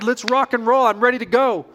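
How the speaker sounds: usual speaking voice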